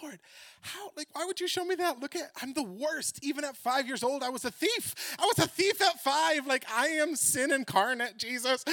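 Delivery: high, loud, upset pleading; worked up. Retold or imitated. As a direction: wail at the Lord that you're the worst. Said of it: "I'm getting so worked up".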